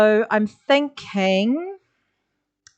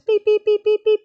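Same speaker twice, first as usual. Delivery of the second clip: high, even truck-reversing beeps; 12 semitones higher, 3 dB louder than usual. Shown as a demonstration. Teacher voicing a reversing truck for the class, high beeps. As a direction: beep like a truck backing up. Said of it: "and reverse".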